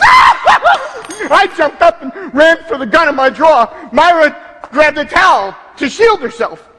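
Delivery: high, agitated account of an emergency; panicked retelling. Acted. Acting out panic at an intruder.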